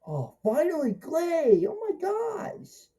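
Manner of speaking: thrilled to see Clay at last; excited. Retold or imitated. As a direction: squeal it like a delighted fan. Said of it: "I'm like".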